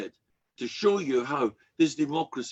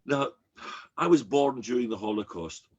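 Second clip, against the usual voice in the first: ordinary delivery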